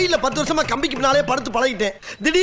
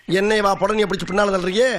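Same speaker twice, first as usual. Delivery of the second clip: usual speaking voice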